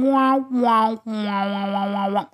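high, loud mock "wah wah" beeps; imitated scan noise. Podcaster mimicking the face-scan app, high mock beeps. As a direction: imitate the machine's whining beeps.

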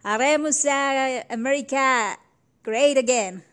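loud, drawn-out mock rallying cry; grito patrio fingido. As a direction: proclaim it slowly and loudly, like a parody of a patriotic grito. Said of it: "Ese grito debió ser algo así como". (grito patriótico de broma, lento y fuerte)

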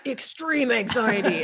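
booming, slow announcer growl; monster truck voice. Acted. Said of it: "read it in, like, a monster truck voice".

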